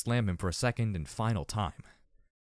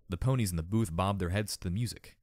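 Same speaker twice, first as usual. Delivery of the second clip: plain reading voice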